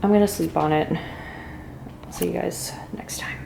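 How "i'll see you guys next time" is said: whispered goodbye. Real, not acted. Soft sign-off whisper.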